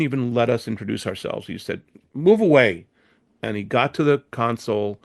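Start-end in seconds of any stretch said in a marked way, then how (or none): 2.1-2.9 barked at the producers; loud, rude order. Retold or imitated. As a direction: shove them aside with your voice. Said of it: "very loud".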